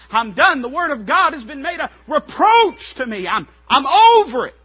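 high, loud cry of protest; loud complaining. Acted. Jeremiah complaining to God, acted loud anguished protest. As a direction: wail out the complaint at preaching force. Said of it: "Jeremiah was complaining and weeping and crying".

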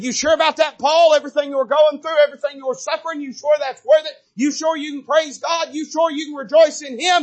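put-on high, loud taunting voice of the old self; old king taunting. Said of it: "that old king reared up in him".

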